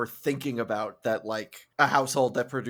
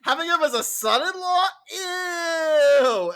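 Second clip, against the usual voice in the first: much higher, hammed-up whiny father; mocking disgusted father; 14 semitones higher, 7 dB louder, faster than usual. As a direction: squeal it like a grossed-out dad. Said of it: "He's like".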